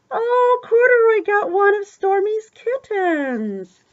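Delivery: high, loud, drawn-out gushing; slow high-pitched squeal of delight; delighted surprise. Pretend. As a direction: gush over the reveal for a small child. Gasping reveal of gift in book.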